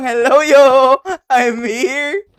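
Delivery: hammed-up cheerful jump-scare voice; imitating the ghost. Streamer acting out the ghost's entrance, loud mock greeting. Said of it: "Para bang".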